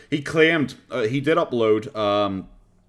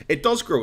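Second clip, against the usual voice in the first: DOES hit higher and louder; emphatic correction; 8 semitones higher, 6 dB louder than usual. Real, not acted. Streamer correcting a claim about the stomach, emphatic DOES. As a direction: punch the word DOES, then fall.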